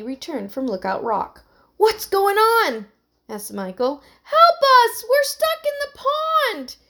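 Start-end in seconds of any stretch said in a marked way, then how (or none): 1.8-2.9 high, loud, drawn-out question; calling out. A narrator's reading. Michael arriving at the pond, calling out. 4.2-6.8 very high cry for help; desperate shout. A narrator's reading. Emily to Michael from the pond, shouting. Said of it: "shouted Emily".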